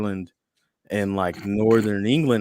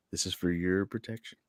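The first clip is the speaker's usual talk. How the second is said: softer, hushed mobster repeat; mafia line; 3 semitones lower, 8 dB quieter than usual. Retold or imitated. Uncle Sam quietly repeating a mafia threat, softer. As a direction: lean in and murmur it like a mob threat. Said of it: "that good old mafia".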